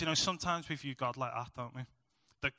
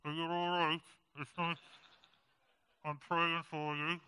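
muffled and softer, as if through a hoodie; mock caring husband; 2 semitones higher, 5 dB quieter than usual. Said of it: "and I was like".

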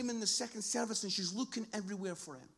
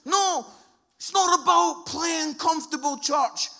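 loud, raised preaching shout; emphatic rebuke; 6 semitones higher, 13 dB louder than usual. Acted. God speaking to the church, emphatic rebuke. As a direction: cry out a correction to the church.

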